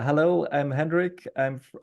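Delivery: normal talking voice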